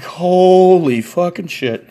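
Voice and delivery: slow, stunned cursing; drawn-out disbelief. Retold or imitated. Storyteller reacting to friend's odd answer, drawn-out disbelief. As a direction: stretch it out slowly, incredulous.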